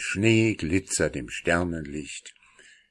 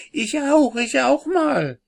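put-on much higher, pleading child voice; eager little girl; 4 dB louder than usual. A narrator's reading. Little sister hopping about, begging for a turn. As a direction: beg for your turn like a small girl.

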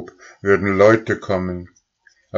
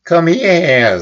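much higher, loud taunt; taunting cow; 12 semitones higher, 8 dB louder than usual. A narrator's reading. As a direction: call him over to be tossed. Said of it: "sagte Goldlilie".

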